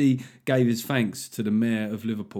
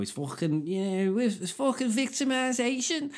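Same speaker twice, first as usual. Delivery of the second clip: high-pitched Scouse whine of complaint; exaggerated Scouse protest, pitched way up; bad Scouse accent; 11 semitones higher, 4 dB quieter than usual. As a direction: put on a hammy Liverpool accent, climbing high. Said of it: "stick off the Scousers this week for my bad accent".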